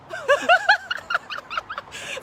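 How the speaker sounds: a sudden high-pitched burst of laughter. Real, not acted. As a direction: laugh out at your own joke. Laughs at own phrase.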